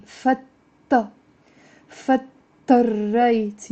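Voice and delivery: fattarrayt stretched very slowly with doubled t and rolled r; slow drilled word. Shown as a demonstration. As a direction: draw fattarrayt out syllable by syllable. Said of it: "Say with me".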